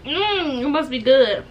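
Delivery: talking while chewing; muffled chewing between words; mouth partly full, chewing. Real, not acted. Seen 0: Holds food and fork; lips pursed, jaw working between bites.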